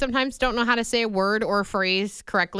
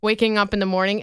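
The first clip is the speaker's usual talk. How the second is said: ordinary talk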